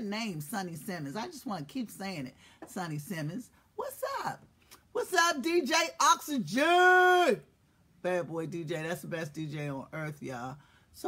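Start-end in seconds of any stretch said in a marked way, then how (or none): 3.7-7.4 loud high drawn greeting; excited shout-out. Real, not acted. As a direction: hype up the DJ.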